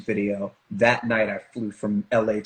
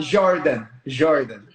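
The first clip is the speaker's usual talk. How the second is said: put-on louder, higher Brazilian-accented "Jordan"; imitated Brazilian accent; 4 semitones higher, 6 dB louder, slower than usual. Guest playing at introducing themselves, imitated Brazilian accent. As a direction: proudly announce "Jordan" as Brazilian "Zhardan". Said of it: "introduce yourself as Jordan".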